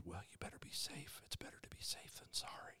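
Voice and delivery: hushed, whispered voice of caution; very soft cautionary whisper; coward's whisper. Acted. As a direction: whisper it like an inner coward urging safety. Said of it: "The coward is constantly whispering".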